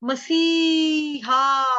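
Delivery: मसीहा drawn out with a long सी. Shown as a demonstration. Teacher contrasting मसीहा with मसि. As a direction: stretch the सी in मसीहा. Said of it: "मसीहा में बड़ी की मात्रा होती है सी".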